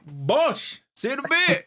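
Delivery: high, gleeful send-off; cheeky high quip. Retold or imitated. Player bragging about bottling a guy, high glee. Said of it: "And I was like".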